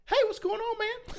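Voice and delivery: high, friendly dude voice up top; singer's greeting. Retold or imitated. Death metal singer greeting the young fan, high and friendly. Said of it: "and he goes".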